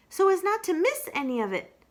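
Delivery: eager add-on; Piglet voice. Acted.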